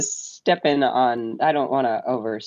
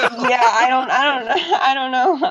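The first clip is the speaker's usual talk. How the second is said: flustered laugh; 11 semitones higher, 5 dB louder than usual. Real, not acted. Player flustered by the question.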